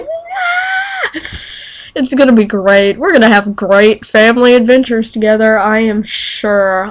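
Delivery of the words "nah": mock panicked scream. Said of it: "And I'm gonna be like".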